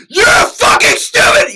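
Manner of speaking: high, loud tearful scream; screamed line. Shown as a demonstration. Actor repeating director's line, screaming. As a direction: shriek it back exactly as instructed. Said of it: "the person in response says".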